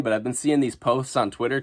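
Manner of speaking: ordinary delivery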